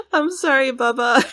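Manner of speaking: laughing apology. Real, not acted. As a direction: apologise while cracking up.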